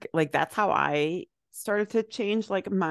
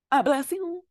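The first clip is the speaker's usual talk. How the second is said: high playful voice; 9 semitones higher than usual. Pretend. Playful high voice after sneeze.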